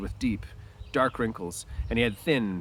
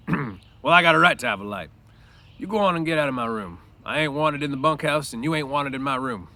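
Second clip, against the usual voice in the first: louder, higher, resentful order; angry Crooks; 4 semitones higher, 5 dB louder than usual. A narrator's reading. Crooks telling Lenny to get out of his room. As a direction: push him out the door with words.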